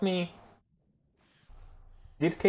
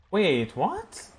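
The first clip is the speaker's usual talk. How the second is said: higher, rising what with stress on what; baffled again; 8 semitones higher than usual. Real, not acted. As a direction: ask it again, still stunned. Repeats the confused question.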